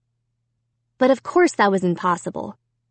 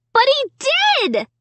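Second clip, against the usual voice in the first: very high, drawn-out scream of protest; slower than usual. A narrator's reading. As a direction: scream it desperately, high and slow. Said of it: "I screamed".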